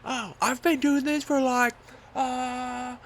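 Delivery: high, loud surfer-dude voice for the instructor; imitating the instructor. Instructor answering the nervous jumper, in a high loud dude voice. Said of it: "And he was like".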